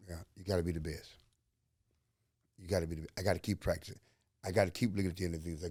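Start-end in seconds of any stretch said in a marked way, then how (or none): none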